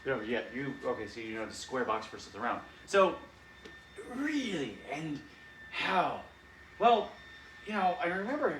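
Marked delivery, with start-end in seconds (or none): 4.2-6.2 incredulous. Acted. Cobra Commander's shock again. 6.8-8.5 eager. Acted. David starts his evil story.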